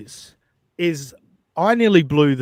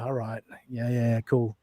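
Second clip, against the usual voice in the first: unimpressed reaction; 4 semitones lower, 7 dB quieter than usual. Retold or imitated. Unimpressed reaction to a cocky host.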